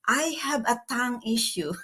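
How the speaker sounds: ordinary talk, nothing marked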